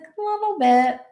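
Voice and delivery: put-on high little-kid voice; young boy. Young nephew answering his aunt, imitated high child voice. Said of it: "He's the one that's young".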